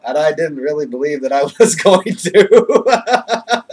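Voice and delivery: words breaking into real laughter; giddy, can't stop laughing. Real, not acted. Giddy about his big news.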